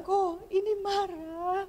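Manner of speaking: high, soft, slow imitation with a cry in it; mock the husband's confused question; lightly voiced husband; husband's puzzled question. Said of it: "sayangnya bilang".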